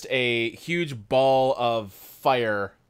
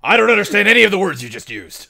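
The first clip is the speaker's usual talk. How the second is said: loud, quick and higher confession; barking soldier; 7 semitones higher, 8 dB louder, faster than usual. Acted. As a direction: report total confusion at military volume. Soldier dog admits total confusion.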